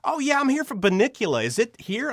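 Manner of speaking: a hint of a high, casual Sean; breezy lost-visitor reply; lightly voiced actor. Sean Astin asking where the Bunnicula session is, casually.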